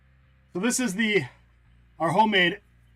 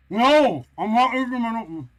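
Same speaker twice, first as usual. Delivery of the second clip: sudden loud no; 3 semitones higher, 6 dB louder than usual. Real, not acted. Suddenly stops herself.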